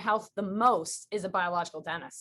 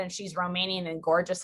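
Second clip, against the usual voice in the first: ordinary talk, nothing marked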